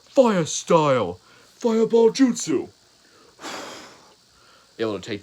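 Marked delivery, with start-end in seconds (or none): 0.0-2.7 very loud, high, drawn-out battle cry; yelled attack. Acted. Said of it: "and they both yell". 3.4-3.9 breath-only fireball whoosh; imitated whoosh.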